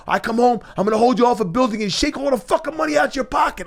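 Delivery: high, loud, fast retold threat yelled down the phone; screaming threat. Threatening the lawyer on the phone, retold high furious yell. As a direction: scream it like you want to shake him down. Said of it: "I'm screaming on the phone".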